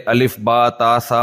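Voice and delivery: slow, deliberate alphabet recitation; letters drawn out one by one; spelled out slowly. Shown as a demonstration. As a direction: sound out each letter slowly like a beginner's primer. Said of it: "تاکہ وہ اپنی تجویز صحیح، صحیح کرے".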